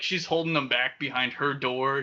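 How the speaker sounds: ordinary delivery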